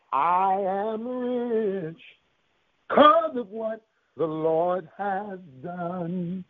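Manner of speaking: very slow, drawn-out proclamation; preached scripture declaration. Real, not acted. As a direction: stretch the key words long and sustained.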